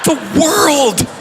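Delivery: high, dramatic cry; mock-epic exclamation; big reveal. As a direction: announce it as a huge, ominous arrival. Repeats the reveal for effect.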